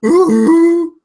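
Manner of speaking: loud, high Wookiee hoot; Wookiee howl. Acted. Wookiee player howls before speaking to Zota. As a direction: give a big rising Wookiee croon.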